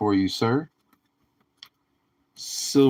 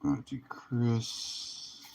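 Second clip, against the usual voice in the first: slow, softer words through a yawn; 10 dB quieter, slower than usual. Real, not acted. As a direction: drag it out mid-yawn. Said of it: "excuse me for yawning".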